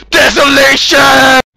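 hammed-up name announcement; drawn-out name shout. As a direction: belt the name out like a wrestler. Announcing his own name.

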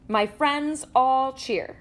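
ordinary delivery, nothing marked

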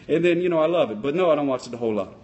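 ordinary talk

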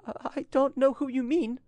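high, frightened woman's voice; trembling voice. A narrator's reading. Frightened girl denies knowing the rival, trembling. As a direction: falter on the first word, pitched high with fear. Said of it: "said in a trembling voice".

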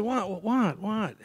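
fed up, push them for the answer; exasperated what. Retold or imitated. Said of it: "I'm getting a little tired of this".

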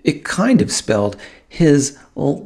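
plain reading voice

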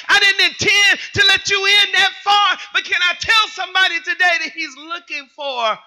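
high-pitched preaching shout; full-force preaching. Real, not acted. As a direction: hold the height and push it at the congregation.

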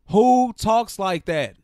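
loud, high, slow disbelief; drawn-out incredulous question; exasperated. Real, not acted. Fan reacting to the press conference, loudly exasperated.

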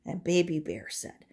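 ordinary delivery

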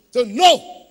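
loud, forceful refusal; emphatic shout. Real, not acted. Preacher rejecting the name.

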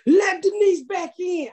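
high, slow, loud crowd holler; imitated yell. Fans shouting show lines at her show, imitated yell. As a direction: mimic a heckler calling out a line. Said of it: "They've started to yell out".

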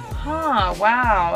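ordinary delivery

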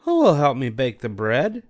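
a hen politely asking a neighbour; Little Red Hen. A narrator's reading. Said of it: "said the Little Red Hen".

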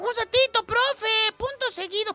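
high, soft pleading pupil; whiny student. Retold or imitated. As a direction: schoolkid who fell behind asks teacher to wait. Student asking to wait.

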